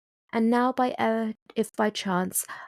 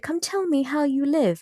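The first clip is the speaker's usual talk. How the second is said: crying out; 5 semitones higher than usual. A narrator's reading. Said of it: "I cried".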